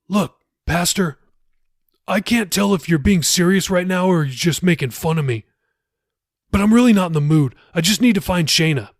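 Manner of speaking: fed up and not hiding it; annoyed. Acted. Jacob tells the pastor he isn't in the mood, irritated.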